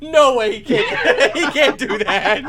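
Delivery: loud, high, laughing protest; laughing outrage. Real, not acted. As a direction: cry foul through laughter. Outraged laughing at goat leaving.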